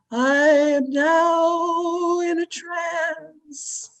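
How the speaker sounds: very slow, droning medium in a trance. Acted. Medium announces she is in a trance, slow hollow-theatrical intoning. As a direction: drag every word out like a voice from beyond. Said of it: "I will go into a trance".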